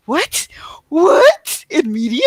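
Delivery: disbelieving cry of what, hammed up; mock disbelief. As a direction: play it as faked astonishment rising. Mock disbelief at positive Christianity.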